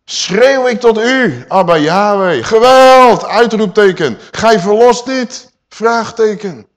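loud, high, slow outcry; crying out to God. Acted. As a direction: shout your plea at heaven. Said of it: "Hij schreeuwt tot vader". (slepend schreeuwen tot God)

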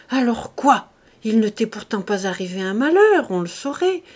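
ordinary delivery, nothing marked

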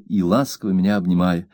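ordinary delivery, nothing marked

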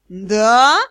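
loud, incredulous rising roar; roared question. A narrator's reading. Magister in pain challenges the student, roaring. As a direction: swell angrily up into the question. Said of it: "взревел Элохор".

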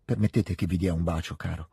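soft, low and affectionate; tender request. A narrator's reading. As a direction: ask shyly and fondly, voice dropping away. Petia commosso chiede un bacio. (richiesta tenera, a voce bassa)

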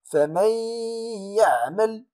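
فمن يعمل with the nun merged into ya and a two-beat nasal hum; nasalized merge. Shown as a demonstration. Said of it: "أقول".

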